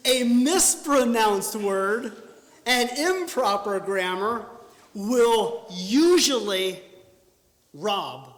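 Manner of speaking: slow, loud preacher's emphasis; slow booming emphasis. Real, not acted. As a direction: drive the point home, slow and loud. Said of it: "emphatic slow key point".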